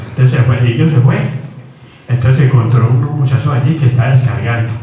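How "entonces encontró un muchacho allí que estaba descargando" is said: ordinary talk, nothing marked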